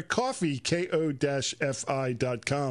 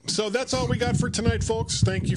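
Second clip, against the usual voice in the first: raised, upbeat show-closing announcement; sign-off voice; 8 semitones higher, 4 dB louder than usual. Acted. Host signing off the livecast for listeners, in an upbeat announcer voice.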